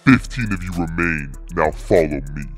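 usual speaking voice

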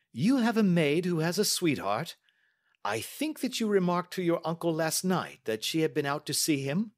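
high, brisk questioning detective; Holmes. Acted. Detective questioning the niece about the maid, probing.

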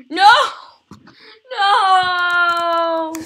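long, high wail of defeat; anguished yell. Real, not acted. Player who is about to die, anguished yell. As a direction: scream it out, stretching the vowel. Dies in the game.

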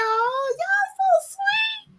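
very high, slow and soft; sweet high thank-you; touched falsetto. Real, not acted. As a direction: coo it to the fans. Thanking viewers.

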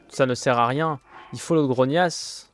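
loud, exasperated complaint; loud gamer frustration; frustrated outburst. Real, not acted. Frustrated gamer yelling at game.

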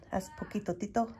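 ordinary delivery, nothing marked

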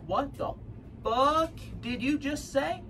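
acted-out appalled woman, pitched high; stunned disbelief. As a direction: stretch the question out in quiet shock. Voicing stunned woman's angry reply.

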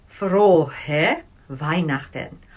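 Frohe Weihnachten said very slowly, FRO-heh VINE-nakh-ten; slow German demo. Shown as a demonstration. Teacher showing students the greeting, very slow and clear. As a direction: model Frohe Weihnachten syllable by syllable. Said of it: "So you say".

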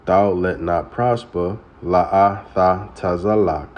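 "La'atha Tazalak" slow, la-a-tha ta-za-lak; Hebrew pronunciation. Shown as a demonstration. Preacher slowly teaching "La'atha Tazalak" as la-a-tha ta-za-lak. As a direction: draw out "La'atha Tazalak" syllable by syllable. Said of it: "That is how you say it in Hebrew".

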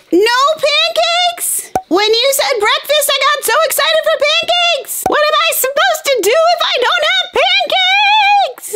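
a high squealing tantrum over breakfast; pancake meltdown. Acted. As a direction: wail about the missing pancakes like a little kid. Kid melts down over pancakes.

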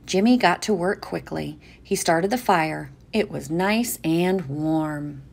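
normal talking voice

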